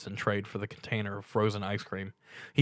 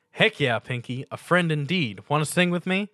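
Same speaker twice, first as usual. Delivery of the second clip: rising, eager and cheerful; excited Stuart; 6 semitones higher, 6 dB louder than usual. A narrator's reading. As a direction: invite a friend to sing along.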